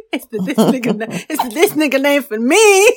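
can't stop laughing while talking. Real, not acted. Laughing at the quote.

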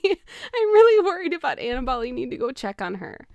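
imitating Billy in a rush of worry, half laughing; worried Billy. Billy voiced in retold movie.

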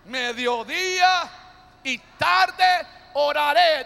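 slow, drawn-out call building to a loud finish; rising chant ending loud on oraré. Shown as a demonstration. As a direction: stretch each word, then hit tarde oraré loud. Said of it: "Otra vez, dígalo fuerte".

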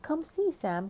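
high, eager call. A narrator's reading. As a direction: call someone over, pitched well up. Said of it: "called Stella".

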